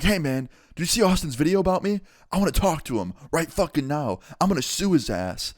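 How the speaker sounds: high-pitched mock angry rant; retold outrage. Former fan demanding to talk to the YouTuber, mock outraged.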